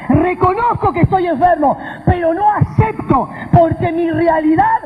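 high, impassioned preaching; preacher declaring defiant faith; fervent declaration. Real, not acted. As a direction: proclaim it with rising conviction.

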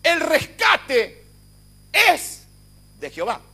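shouted high, very slow and drawn out; fiery Pentecostal declaration; preaching at full force. Acted. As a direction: proclaim it to shake the room. Preacher erupts into proclamation.

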